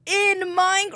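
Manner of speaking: sudden high, loud voice; high loud squeal. Pretend. Jokey punchline tag.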